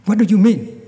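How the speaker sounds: loud, high angry challenge; angry demand. Acted. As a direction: fling it at him, furious. Angry bandit confronts the Buddha.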